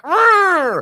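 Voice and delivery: loud high pirate-like growl; frustrated growl. Retold or imitated. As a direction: let out a frustrated arr. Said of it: "And I'm like".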